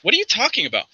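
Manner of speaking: high, oblivious denial; feigned innocence. Acted. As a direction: play dumb in a lightly childlike voice. Chester feigns ignorance about sword.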